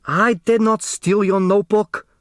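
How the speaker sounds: high, indignant denial; indignant man denying theft. A narrator's reading. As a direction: protest your innocence, offended. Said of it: "the indignant Hu explained".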